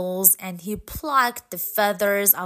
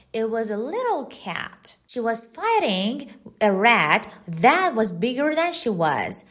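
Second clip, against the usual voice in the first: plain reading voice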